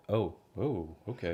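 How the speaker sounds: soft mock realisation; imitated hesitant reaction. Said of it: "you're like".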